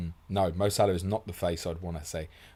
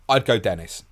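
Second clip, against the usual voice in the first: louder, punched final answer; emphatic verdict; 3 semitones higher, 9 dB louder than usual. Real, not acted. Answering a listener's bench question, emphatic. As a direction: land the call with force.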